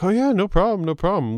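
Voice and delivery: high, easy "no problem"; breezy mock agreeable. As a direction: toss it off cheerfully. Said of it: "they were like".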